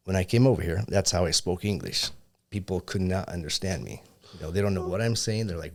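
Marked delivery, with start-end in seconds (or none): none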